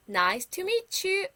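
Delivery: slow, high, careful "Nice to meet you."; slow model phrase. Shown as a demonstration. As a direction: say each word slowly for children to copy. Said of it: "다시 한번". (천천히 또박또박 높게 "Nice to meet you.")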